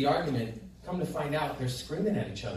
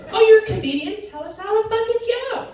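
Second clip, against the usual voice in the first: much higher, louder mocking stranger; exaggerated mock; 11 dB louder than usual. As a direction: blow up the stranger's question into a mock yell.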